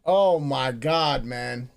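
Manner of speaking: normal talking voice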